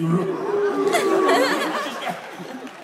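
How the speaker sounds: loud high burst of laughter; real laugh at own joke; big laugh. Real, not acted. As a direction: crack up out loud. Laughing at steroid guy.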